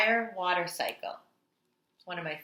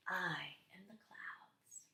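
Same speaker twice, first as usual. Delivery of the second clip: whispered reveal; 17 dB quieter, slower than usual. Acted. Teacher whispering the answer to the children. Hushed reveal in read-aloud.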